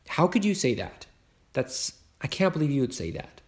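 acted hurt husband, mildly indignant; hurt indignation. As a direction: act out a wounded protest. Acted marital quarrel, husband hurt.

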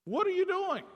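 high-pitched mock parental exasperation; acted indignant question, pitch jumping up; exasperated demand. Father confronting son at his door, exasperated.

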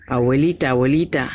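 plain reading voice

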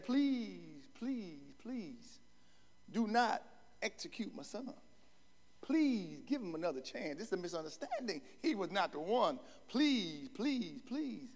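hushed begging mother, lightly voiced; pleading mother. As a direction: plead quietly for your son's life.

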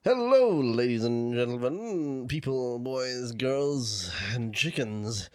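grand intro. Acted. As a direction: introduce them with pompous ceremony. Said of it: "Why'd you always introduce".

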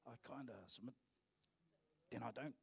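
muttered, low and soft; a lazy shrug of a vow; mock half-hearted believer. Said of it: "There's a kind of a submission".

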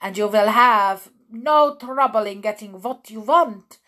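put-on loud foreign-accented command; Grand High Witch shouting. A narrator's reading. Grand High Witch reassuring witches, loud accented shout. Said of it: "shouted the Grand High Witch".

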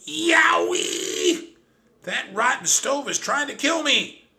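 very high, loud pained scream. Acted. Trap burned by the stove, screaming high and loud. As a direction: yell in pain at the stove. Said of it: "I heard a mouse screaming".

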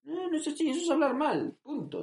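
high-pitched hammed-up protest; mock indignant. Language purist objecting to Andalusian, mock indignant. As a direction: scold in a comically high voice.

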